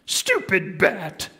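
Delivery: high, slow pouty huff; grumpy Grover. Acted. Grover kicking a can, slow high pout. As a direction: kick it and grumble. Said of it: "letting me do the voices".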